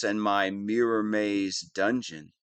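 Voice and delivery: ordinary delivery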